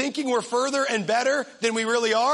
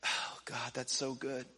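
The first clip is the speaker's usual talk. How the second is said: very soft, low, mock-devout murmur; put-on reverent sigh of agreement; hushed awe; 9 semitones lower, 15 dB quieter than usual. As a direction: act deeply moved, almost under your breath. Acts out a hushed prayerful response.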